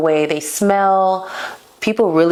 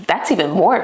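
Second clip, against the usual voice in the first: normal talking voice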